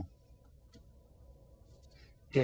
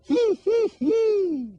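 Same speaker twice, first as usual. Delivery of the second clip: very high, loud whinny; high loud horse whinny; 7 dB louder, much slower than usual. Shown as a demonstration. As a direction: neigh like a horse. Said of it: "Who says".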